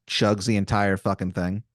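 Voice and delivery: ordinary talk, nothing marked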